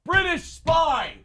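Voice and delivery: high, shouted British accusation; mock-alarmed yell, last word stretched; shouting British officer. Heckling the actor's old role, high British officer shout. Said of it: "I'm sitting here going".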